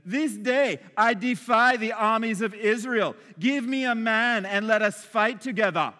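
hammed-up Eastern European warrior taunt; Goliath. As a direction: hurl a defiant challenge as an Eastern European villain. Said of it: "Eastern European accent".

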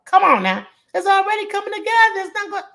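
sudden high, loud burst of excitement; hyped up. Real, not acted. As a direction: cheer the pie on like a hype man. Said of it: "Why I'm talking like this".

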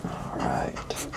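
soft whisper. Real, not acted. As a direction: breathe it out as a whisper. Whispered aside.

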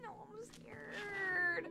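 scared. Real, not acted. Scared in horror game.

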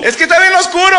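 put-on high whiny child; pleading like a scared five-year-old; lightly voiced scared daughter; little girl voice. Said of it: "Y me habla".

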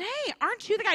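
high, pointed accusing question; accusing bystander. Acted. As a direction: poke at him with suspicion.

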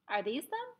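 usual speaking voice

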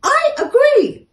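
very high, loud squeal of agreement; kangaroo agreeing. A narrator's reading. Kangaroo agreeing with the door, shrill and loud. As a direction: squeak a gleeful yes. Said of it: "said the kangaroo".

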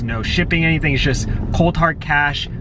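ordinary delivery

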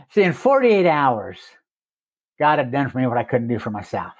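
usual speaking voice

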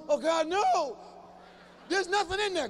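put-on high, loud, desperate childish protest; whining kid. Kid dreading being sent to a bare room. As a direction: beg not to be sent there.

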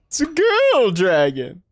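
loud, high, stretched-out exclamation; drawn-out playful shout. Pretend. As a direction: belt it out slowly and high. Shouted joke repeated.

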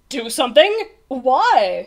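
puzzled, smiling questions; smiling unconcern. A narrator's reading. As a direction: question it with a carefree smile. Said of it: "smiled the captain".

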